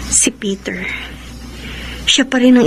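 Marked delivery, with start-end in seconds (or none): 0.1-0.8 breathy whisper of a longed-for name. Acted. Jessica alone, longing for Peter, whispering his name. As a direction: hush the name like a secret.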